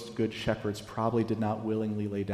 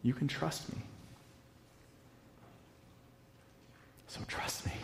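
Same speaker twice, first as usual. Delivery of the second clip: soft reassurance; 4 semitones higher, 15 dB quieter than usual. Acted. Jesus reassuring his sheep, hushed and tender. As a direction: drop much softer, almost a whispered promise.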